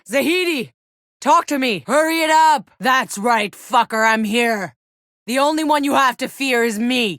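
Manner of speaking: high, loud battle yells over the gunfire; combat shouting. Acted. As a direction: bellow it out mid-fight. Combat, calling out to ally and enemies.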